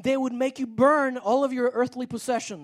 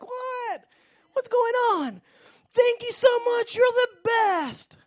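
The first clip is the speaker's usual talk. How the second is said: put-on excited boy; 13 semitones higher, 5 dB louder, slower than usual. Boy's voice continues excitedly.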